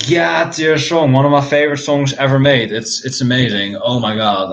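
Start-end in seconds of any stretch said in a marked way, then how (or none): none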